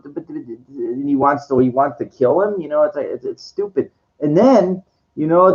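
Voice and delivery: usual speaking voice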